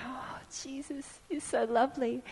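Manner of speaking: a whispered sigh of adoration; breathy awe. Retold or imitated. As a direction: melt into a hushed whisper. Heart melting toward Jesus.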